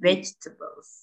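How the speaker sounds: "Vegetables" as VEJ-tbls, middle swallowed; silent middle. Shown as a demonstration. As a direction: model "Vegetables" without sounding out "table". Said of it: "ininya nggak dibaca". ("Vegetables" dibaca VÉJ-te-bels, tengahnya hilang)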